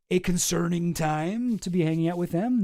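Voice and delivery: ordinary talk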